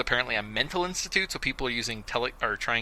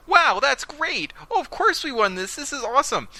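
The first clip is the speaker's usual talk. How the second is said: high, loud, hammed-up delight; mock thrilled Ash; mock excitement; 6 dB louder than usual. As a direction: gush like a gullible kid. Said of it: "Ash goes".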